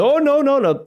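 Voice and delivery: acted-out "not me" objection; mock protest. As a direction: wave it away in an acted protest. Haggling protest acted out as example.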